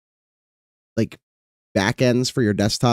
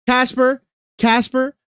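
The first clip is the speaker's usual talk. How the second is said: calling out; 12 semitones higher, slower than usual. Real, not acted. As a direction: yell across the room. Calling out to Casper.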